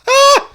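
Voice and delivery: short high, loud shriek; mock scream. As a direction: let out a sudden piercing "Ah!". Reacting to horror campaigns question.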